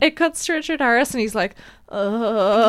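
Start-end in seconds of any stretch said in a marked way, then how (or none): 1.9-2.7 a loud, broken-sounding groan, imitating an old actor; mock anguished "oh"; broken, worn groan. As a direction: play it like a shattered old prisoner. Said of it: "He's so broken and worn looking".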